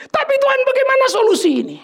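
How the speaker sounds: very high-pitched mock wail to God; desperate pleading. Believer begs God for a solution, high desperate plea.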